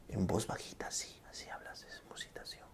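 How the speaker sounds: soft breathy whisper, barely audible. Shown as a demonstration. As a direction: drop to a whisper to show it. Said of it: "es cuando hablas en bajo volumen".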